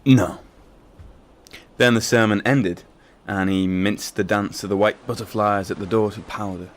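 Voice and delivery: loud, forceful declaiming. Acted. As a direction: project it to the back row.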